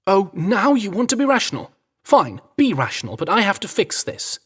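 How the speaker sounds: high, loud exasperated snap; exasperated outburst. A narrator's reading. Staff man Henry arguing with the American, exasperated shout. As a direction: throw his words back at him, fed up.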